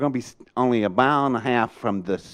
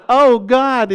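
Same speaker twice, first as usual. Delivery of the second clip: high, loud retold dismay; the colonel's horrified gasp of a prayer; panicked groan; 12 semitones higher, 8 dB louder than usual. Colonel hitting the red button, alarmed.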